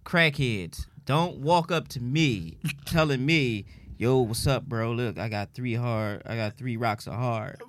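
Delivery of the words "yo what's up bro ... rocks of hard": low, hushed street pitch; crackhead hustler voice. Retold or imitated. Crackhead pitching crack to him at work, low and hushed. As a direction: lean in low like selling rocks on the corner.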